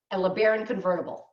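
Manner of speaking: usual speaking voice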